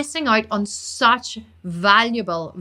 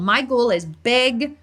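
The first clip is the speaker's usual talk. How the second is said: ordinary talk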